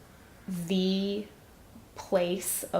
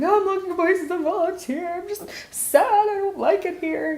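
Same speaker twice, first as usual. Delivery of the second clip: much higher, faster, mock-pitiful whine; mock sad whine; 12 semitones higher, 4 dB louder than usual. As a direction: play up her own sulky sadness, pitched way up. Retold own sad complaint to husband.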